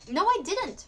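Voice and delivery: put-on boy cries out his innocence; cried out. A narrator's reading. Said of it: "Mike cried".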